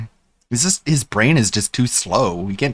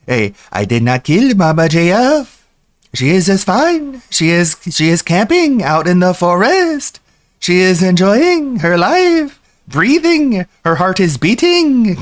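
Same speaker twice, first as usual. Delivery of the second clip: higher, louder French Canadian impression; French Canadian accent; 6 semitones higher, 3 dB louder than usual. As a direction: doe J F na met een French Canadian accent. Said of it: "that weird, like, Canadian accent where it's like that French Canadian thing".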